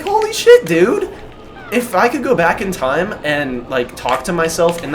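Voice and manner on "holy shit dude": stunned exclamation. Real, not acted. Disbelief at his own words.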